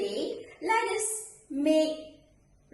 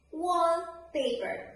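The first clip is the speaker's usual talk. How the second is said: "Wallpaper" slowly, WALL stressed, paper falling; stress on first noun; 3 semitones higher, slower than usual. Shown as a demonstration. Teacher presenting example number two, slow stress on WALL in "Wallpaper". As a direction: lean on "Wall" in "Wallpaper", drawn out. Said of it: "put the stress on the first noun".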